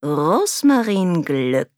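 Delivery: slow, drawn-out villain's greeting; gloating count. A narrator's reading. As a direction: relish the reunion like a sly villain. Count gloats over trapped Rose.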